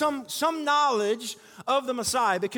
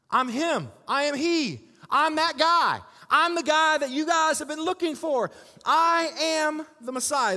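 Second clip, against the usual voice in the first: Jesus proclaiming, louder and higher as it goes; building proclamation; 5 semitones higher, 4 dB louder than usual. Acted. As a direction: build each line until it rings out. Jesus declaring himself, building.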